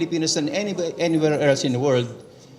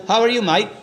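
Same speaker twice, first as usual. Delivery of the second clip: hammed-up Australian accent, high and bright; Australian greeting; 7 semitones higher, 4 dB louder than usual. Presenter mimics how Australians greet, a mock Australian accent. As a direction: throw a cheery Aussie how are you mate, pitched high. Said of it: "Ganun sila eh".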